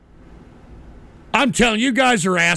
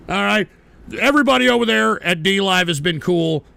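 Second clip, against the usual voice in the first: ordinary delivery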